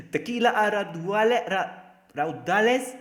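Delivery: loud, high attempt at a Spanish accent. Shown as a demonstration. Streamer reading out a viewer's Spanish phrase, in a loud Spanish accent. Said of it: "Do I have the accent or no?".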